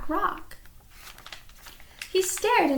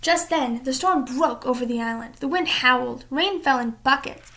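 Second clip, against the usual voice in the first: ordinary delivery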